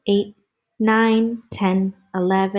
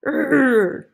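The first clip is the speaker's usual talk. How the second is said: higher, loud playful growl; frustrated growl; 6 semitones higher, 5 dB louder than usual. Pretend. Reader acts out the angry maker, frustrated growl.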